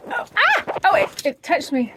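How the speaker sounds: high squeal of surprise; startled yelp. Real, not acted. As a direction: jump as it brushes you. Bird touches her.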